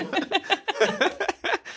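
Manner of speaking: high, helpless giggling; helpless laughter. Real, not acted. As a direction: laugh until you can't stop. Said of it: "can't stop laughing now".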